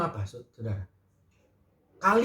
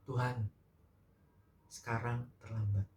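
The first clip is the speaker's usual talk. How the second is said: quiet weeping; 5 semitones lower, 8 dB quieter than usual. Acted. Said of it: "Sambil dia menangis".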